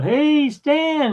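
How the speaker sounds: high-pitched re-enacted holler; drawn-out high shout of greeting; yelling out. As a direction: call out to someone across a crowd. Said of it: "I yelled out".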